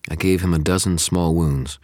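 ordinary delivery

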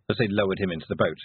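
plain reading voice

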